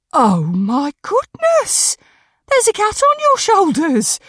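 high, loud gasp of amazement; astonished woman. Acted. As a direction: exclaim in amazement, pitch soaring. Jaw drops seeing the cat.

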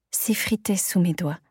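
ordinary delivery

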